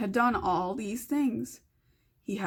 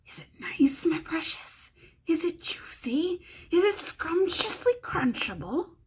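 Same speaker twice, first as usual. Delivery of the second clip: high hissing whisper of a hungry creature; Gollum voice; 7 semitones higher than usual. Acted. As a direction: whisper it greedily, like a slimy cave creature. Gollum voice in read story.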